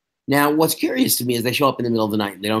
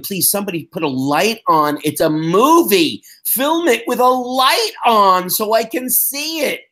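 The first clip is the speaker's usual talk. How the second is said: exasperated plea; 8 semitones higher, 3 dB louder than usual. Retold or imitated. Exasperated plea about lighting.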